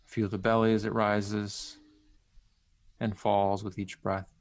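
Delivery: unhurried and gentle, leave long pauses; centering exercise. Acted. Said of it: "lead you through a, a brief centering exercise".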